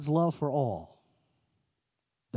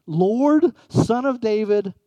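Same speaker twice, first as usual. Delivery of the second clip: raised, higher, louder cry of Lord, Son of David; loud pleading repeat; 4 semitones higher, 7 dB louder, slower than usual. Acted. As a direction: call it out as the desperate mother would. Preacher repeats the woman's cry.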